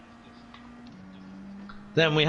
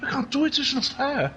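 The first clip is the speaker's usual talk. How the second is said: high, plaintive Welsh whine to Mum; complaining Welsh child; 7 semitones higher than usual. Acted. Child complaining to Mum about seagulls, Welsh protest. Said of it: "I said".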